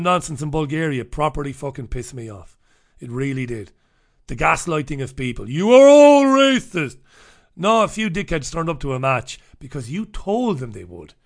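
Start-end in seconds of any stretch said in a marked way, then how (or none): 5.5-7.0 loud, slow mock accusation; high, loud sarcastic jab at the accusers; mocking quote. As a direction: sneer it out like a self-righteous official.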